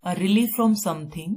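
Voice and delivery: slow, deliberate dictation pace; teacher dictating a definition slowly. Shown as a demonstration. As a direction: read it out slowly for notes. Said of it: "teacher dictating definition".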